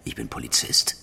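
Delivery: low, soft, voiceless statement; hushed retort. Acted. Inspector refusing to play along, low whisper. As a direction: quietly insist on who you are.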